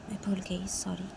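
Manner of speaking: sheepish whisper. Acted. Girl apologizes meekly to father.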